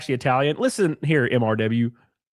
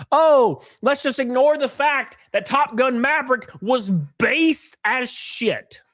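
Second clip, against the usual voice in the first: sarcastic; 6 semitones higher, slower than usual. Acted. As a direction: sneer at the argument. Sarcastic retort.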